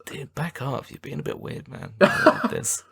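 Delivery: soft, high retort; uneasy friend. Retold or imitated. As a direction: gently fend someone off in a small high voice. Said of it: "And he's just like".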